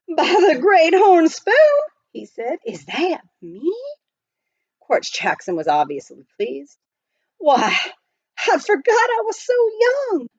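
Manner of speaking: high, loud astonished prospector; amazed old miner. Acted. Miner staring at his reflection, loudly amazed. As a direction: marvel at your own face.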